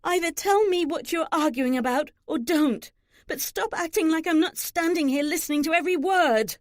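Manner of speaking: high-pitched indignant outburst; angry demand. A narrator's reading. Daughter confronting the two arguing women, angry. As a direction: demand answers, hitting words hard. Daughter interrupts quarrel, after snapping.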